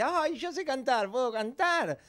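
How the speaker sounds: put-on high, slow eager child; sing-song little girl begging to sing. Girl eagerly asking the teacher, high slow child voice.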